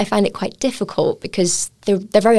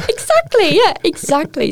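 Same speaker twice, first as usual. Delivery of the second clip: laughing; 14 semitones higher than usual. Real, not acted. Laughing agreement with host.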